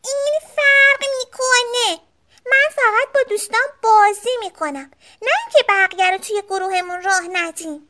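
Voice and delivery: high, indignant sister bear; angry shout. A narrator's reading. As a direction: cry out defensively, high and hurt. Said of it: "با عصبانیت داد زد".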